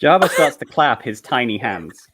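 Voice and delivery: ordinary talk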